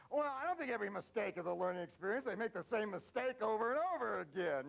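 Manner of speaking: high, loud mock protest; hammed-up indignant complaint; exasperated objector. Acted objection of exasperated people.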